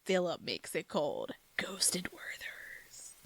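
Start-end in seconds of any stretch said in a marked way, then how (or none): 1.6-3.1 slow, ghostly stretched whisper; very soft drawn-out hush; spooky whisper. Pretend. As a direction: breathe it out like a ghost. Spooky joke on ghost candy.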